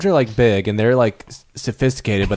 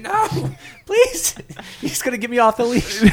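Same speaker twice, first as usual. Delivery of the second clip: much higher, begging; high desperate plea; 10 semitones higher than usual. Pretend. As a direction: beg not to be pulled away. Human pleading being pulled away.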